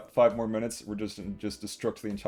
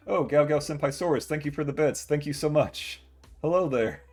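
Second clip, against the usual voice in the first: excited thanks; 6 semitones higher, 6 dB louder than usual. Real, not acted. Thanking a viewer for bits.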